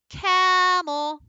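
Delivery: Camel called high and loud, cam held long; calling the dog. Shown as a demonstration. Teacher calling Camel to find the accent. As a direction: call out Camel like a dog's name. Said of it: "Let's try that with camel".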